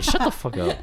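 high-pitched mock irritation; annoyed wife. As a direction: imitate a fed-up wife, half laughing. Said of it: "my wife gets annoyed".

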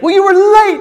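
high, loud angry-dad shout, acted out; mock dad yelling. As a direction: imitate a father shouting his accusation. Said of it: "we just have a yelling match".

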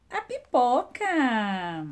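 drawn-out answer reveal; slow reveal. Shown as a demonstration. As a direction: stretch the answer out slowly. (resposta lenta e revelada)

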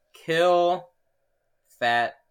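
drawn-out words with long pauses; mock reveal. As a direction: spell out a fake acronym, word by word. Mock reveal of what KFC means.

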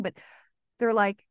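ordinary delivery

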